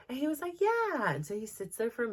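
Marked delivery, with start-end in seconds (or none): 0.6-1.1 put-on high, bright child's yeah; eager little boy. Dylan agreeing to sort for the teacher, high childlike yeah. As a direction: chirp it like a small kid.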